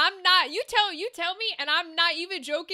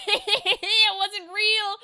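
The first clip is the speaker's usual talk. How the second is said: laughing; 4 semitones higher, much slower than usual. Real, not acted. Laughing at imagined prank reveal.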